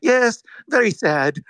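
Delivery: mock his unmoved reply, slowly; emotionless Karpeles. Host repeating the CEO's line, slow and unfeeling. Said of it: "he just didn't have emotion about it".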